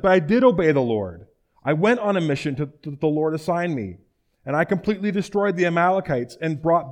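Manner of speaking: loud, defensive self-justification; insistent protest. Acted. Saul defending himself to Samuel, loud and insistent. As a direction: argue your innocence with force.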